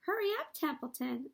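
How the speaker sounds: high, loud mocking mimicry; imitating Wilbur. The rat mocking Wilbur's plea, high and loud. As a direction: parrot his plea back mockingly. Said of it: "He began imitating Wilbur's voice".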